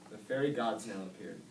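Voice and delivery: usual speaking voice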